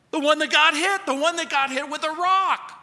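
high, loud punchline answer; emphatic punchline. Acted. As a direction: shout out the obvious answer. Punchline answer to his riddle.

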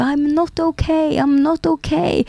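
high, slow, drawn-out mock cry of pain; high-pitched imitation of a scream, held back; retold pained yell. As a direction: imitate a hurt driver yelling over the radio, without real volume. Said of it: "han bara skriker tillbaka".

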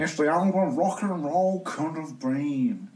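Alan Partridge voice. Retold or imitated. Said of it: "What would Alan Partridge say?".

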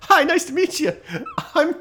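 high, giggly put-on kid greeting; silly kid voice. Greeting the other silly kid, high and giggly. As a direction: squeak it out like a kid meeting someone.

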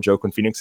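"Joaquin" read as JOE-kwin; a hard J on "Joaquin", rhyming its start with Joe; Joaquin mispronounced. Real, not acted. Host praising his favorite role, Joaquin mispronounced as JOE-kwin. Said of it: "I really need you to say Joaquin".

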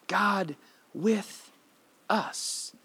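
"God with us" breathed out near whisper; quiet, intimate "God with us"; soft, hushed "God with us". Shown as a demonstration. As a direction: let "God with us" fall to a hush. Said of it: "that means like this".

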